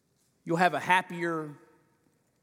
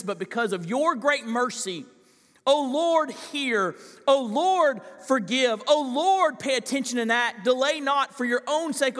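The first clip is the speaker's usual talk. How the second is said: raised, impassioned prayer reading; fervent scripture reading; 5 semitones higher, 3 dB louder than usual. Acted. Preacher reading Daniel's prayer to the congregation, fervent and raised. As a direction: pray the text aloud with rising urgency.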